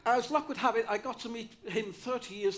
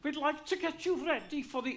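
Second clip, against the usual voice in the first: higher lilting Welsh teacher; 8 semitones higher than usual. Retold or imitated. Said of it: "they all speak like this".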